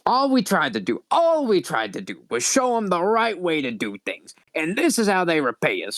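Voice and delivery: raspy, wounded indignation; Lady Vera. Acted. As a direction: rasp out a dramatic lament at their ingratitude. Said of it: "really raspy voice".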